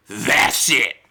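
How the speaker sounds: low, gruff "That's it"; lightly voiced angry old man. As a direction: growl it like a fed-up old man.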